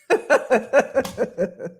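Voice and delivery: amused burst of chuckling; laugh. Real, not acted. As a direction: chuckle after your own question. Laughs after pressing the audience.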